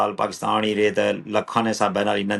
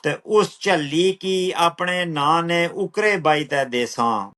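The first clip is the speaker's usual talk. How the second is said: slow, measured verse with drawn-out words; poetry recital; 6 semitones higher, much slower than usual. Acted. Poet reciting own lines to an appreciative listener, measured recital. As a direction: recite it higher, easing down at the close.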